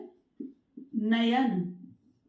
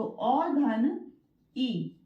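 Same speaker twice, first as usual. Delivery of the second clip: slow syllable split; much slower than usual. Shown as a demonstration. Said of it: "ठीक है".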